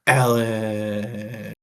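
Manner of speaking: usual speaking voice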